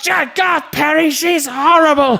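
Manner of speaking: very high hammed-up quarrel voice; mock marital shouting. Couple quarrelling at the door, in mock shouts. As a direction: draw out the complaint at a high pitch.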